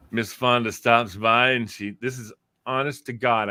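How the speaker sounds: ordinary delivery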